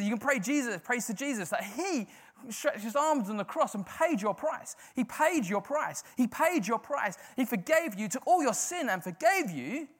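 high, loud rousing sermon; rally the church to celebrate; full-force preaching. Acted.

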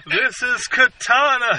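slow stiff "This is Katana", lightly voiced; Rick Flag impression. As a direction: read it like a cue card, barely acted. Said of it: "Rick Flag just fucking shouts".

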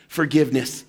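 a soft, low single word of mercy; hushed, tender, falling softly; tender forgiveness. Acted. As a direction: lay it down gently like a gift. Jesus' word to the worst sinners.

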